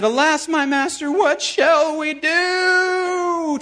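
high, slow, drawn-out cry "what shall we do"; panicked servant. Acted. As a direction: stretch out the servant's fearful wail. Servant panics, repeated quote.

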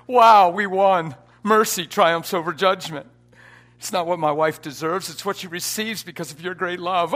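loud, high, exultant proclamation; full-force preaching. Real, not acted. Preacher retelling his triumph leaving the hospital, full-force preaching. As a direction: shout the victory over the lying spirit.

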